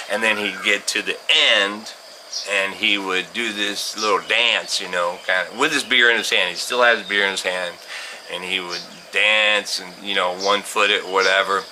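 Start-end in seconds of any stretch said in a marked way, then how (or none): none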